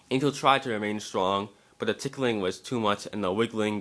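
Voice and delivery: usual speaking voice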